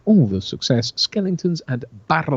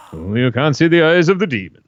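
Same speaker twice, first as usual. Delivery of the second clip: lightly voiced broadcaster impression, slightly raised; a tentative attempt at a famous presenter's voice; film villain's line done as a genial presenter; Wogan; 4 semitones higher, 4 dB louder than usual. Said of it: "do King Willie's lines in Wogan's voice".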